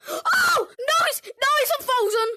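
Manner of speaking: sudden high yelp of alarm, then shouting; startled shout. Real, not acted. Gamer yelling to viewers as the monster unfreezes, startled shout. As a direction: caught off guard, yell it out.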